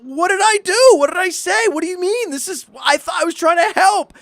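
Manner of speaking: very high, squeaky mock plea; bewildered protest. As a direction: whine innocence in hammed-up falsetto. Retold bewildered plea to angry wife.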